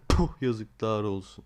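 disappointed. Real, not acted. Crate gives nothing again.

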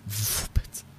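a hushed, breathy whisper of disbelief; broken whisper. Real, not acted. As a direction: let the word fall away into a whisper. Heartbroken aside after a raw confession.

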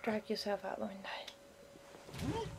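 slow unvoiced whisper; slow hushed urging. Real, not acted. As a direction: coax him out under your breath. Urging character, quietly.

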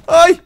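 sharp scared cry; startled yelp. Real, not acted. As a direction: yelp in fright. Zombies break in.

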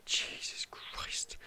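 whispered in shock, very slow; breathless whisper. Real, not acted. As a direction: barely breathe the words out after a fright. After a scare.

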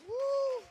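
high, loud "woo"; whoop. Real, not acted. Preacher to congregation after the verdict, exultant whoop.

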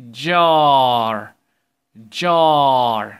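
star said loud and slow, ar stretched; slow loud model reading; drawn-out teaching read. Shown as a demonstration. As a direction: stretch each word so kids copy. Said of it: "يلا مع بعض".

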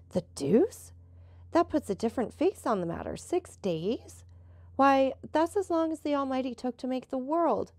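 a touch of astonished protest, pitch lifting; mildly taken aback. A narrator's reading. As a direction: balk at the news, mildly dismayed. Franz learns of six-day quarantine.

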